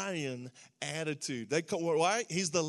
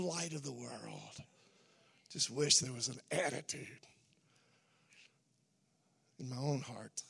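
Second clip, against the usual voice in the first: hushed reflection; 7 semitones lower, 7 dB quieter than usual. Real, not acted. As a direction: turn quietly to your own heart. Drops after the preaching climax.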